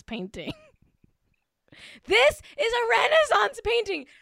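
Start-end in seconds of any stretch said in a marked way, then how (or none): 2.1-4.1 high, insistent gushing; emphatic raving. Real, not acted. Fan raving over a movie still, emphatic and high. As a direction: proclaim it in a high, awestruck voice. Repeats her rave, far higher.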